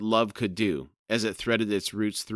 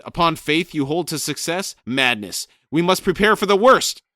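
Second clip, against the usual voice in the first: loud, high furious squawking shout; shouting raven; 8 semitones higher, 9 dB louder than usual. Acted. Crone shrieking at Brood to find Rake, loud and high. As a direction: scream it at him, higher and louder.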